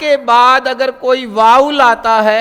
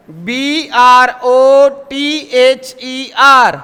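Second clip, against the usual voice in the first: slow, letter by letter; slow spelling for learners; much slower than usual. Shown as a demonstration. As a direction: spell each letter out clearly. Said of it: "spelling out letters slowly".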